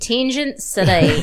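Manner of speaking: slow, drawn-out New Zealand vowels; New Zealand accent. Shown as a demonstration. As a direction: echo it as a New Zealand interpreter. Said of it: "I'll be doing a translation".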